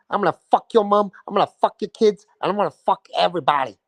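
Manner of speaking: half-joking Tyson impersonation, lisping and crude; a hint of Mike Tyson, mocking and brash; Mike Tyson impression. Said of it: "do a Mike Tyson impre-, impersonation".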